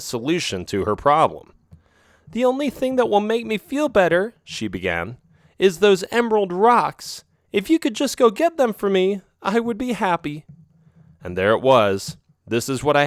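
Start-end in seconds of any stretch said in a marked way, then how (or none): 2.3-4.3 put-on higher, coaxing young female cat voice; sly girlfriend cat. A narrator's reading. Narrator voices Isabel. 5.6-10.4 put-on higher, coaxing young female cat voice; sly girlfriend cat. A narrator's reading. Narrator voices Isabel.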